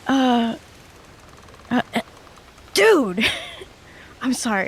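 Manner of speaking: high, hesitant plea with a laugh; limp, drained character. Acted. Limp character dangling in a crewmate's arms, weakly protesting. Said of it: "Haraya's just kind of limp".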